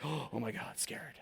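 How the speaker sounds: gasp, then hushed, low fright; scared gasp. Retold or imitated. Frightened listener reacting to an aggressive speaker, scared. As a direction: shrink back with a frightened, soft voice. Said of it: "the other half are gonna be like".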